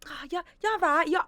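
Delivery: high, mocking stab at an Essex accent; attempted Essex accent. Imitating a smug housemate's reaction in an attempted Essex accent. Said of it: "I can't even do the Essex accent".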